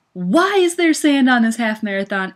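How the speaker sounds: exasperated, emphatic griping; exasperated complaint. Retold or imitated. Runner griping about sand in her half marathon, exasperated.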